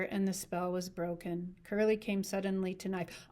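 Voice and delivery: plain reading voice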